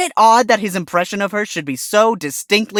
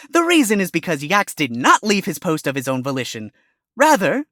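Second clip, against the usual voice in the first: normal talking voice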